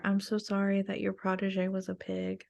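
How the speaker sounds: hushed, soft-voiced apology; whispering in her ear. Player hugging an ally and confiding an apology, hushed. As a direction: say it softly, for one person's ear only. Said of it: "I'm gonna whisper in her ear".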